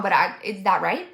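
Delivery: usual speaking voice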